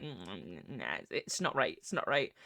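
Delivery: low, soft mulling; quiet, doubtful thinking aloud; pondering to oneself. Retold or imitated. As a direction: turn it down under your breath. Retells own pondering and rejecting.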